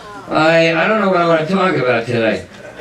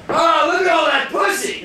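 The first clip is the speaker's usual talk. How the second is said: higher, shouted exclamation; retold yell; 8 semitones higher than usual. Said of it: "I just heard myself yell out".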